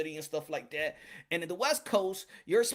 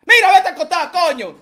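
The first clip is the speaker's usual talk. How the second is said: loud laughing brush-off; 7 semitones higher, 13 dB louder than usual. Real, not acted. Telling partner off, laughing.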